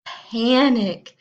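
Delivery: loud, punchy cry of alarm; dramatic exclamation. A narrator's reading. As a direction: call it out like an alarm bell. Narrator exclaims panic.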